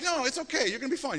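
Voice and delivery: high, soothing coaxing voice; mild mock mother reassuring her boy; coaxing mom. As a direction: reassure the frightened child. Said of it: "You know, like".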